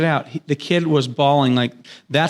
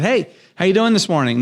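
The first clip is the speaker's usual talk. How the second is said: bright, higher pitched hello to lift his mood; upbeat cheerful greeting; 6 semitones higher than usual. Retold or imitated. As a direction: sound cheery to cheer up a sad kid. Dad calls sad son cheerfully.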